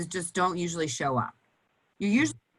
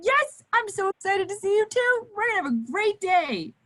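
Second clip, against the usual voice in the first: put-on much higher, gushing thrilled hello to a child; excited greeting. Shown as a demonstration. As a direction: greet the kid like the best news of the day. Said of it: "when you see them and they get really excited".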